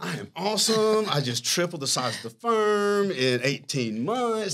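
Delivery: high, hammed-up boasting; mock self-praise. Founder mimicking himself patting his own back, mock boastful. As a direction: crow about yourself like a proud rooster. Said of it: "do the proverbial pat on my back".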